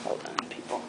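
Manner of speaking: whispered aside to viewers. Real, not acted. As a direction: whisper to the audience.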